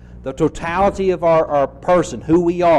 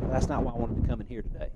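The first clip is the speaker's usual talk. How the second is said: quick, much softer mock complaint; mock grumble; 3 semitones lower, 12 dB quieter, faster than usual. Preacher voicing a bored listener in the pews, mock grumble. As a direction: mutter it like a reluctant churchgoer.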